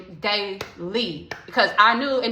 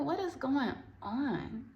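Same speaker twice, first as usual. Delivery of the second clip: slow, soft, bewildered; hushed confusion; 4 semitones higher, 12 dB quieter, slower than usual. Retold or imitated. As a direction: murmur it slowly in disbelief. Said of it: "I'm like".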